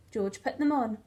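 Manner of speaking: ordinary delivery, nothing marked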